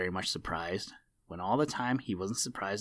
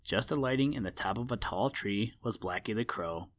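ordinary delivery, nothing marked

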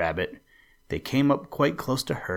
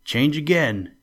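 raised, higher storybook yell, kept in check; mild narrated yell; 5 semitones higher, 5 dB louder than usual. A narrator's reading. Gryphon calling the next dance step, a restrained yell. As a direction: call it out a bit louder and higher. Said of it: "yelled the Gryphon at the top of his voice".

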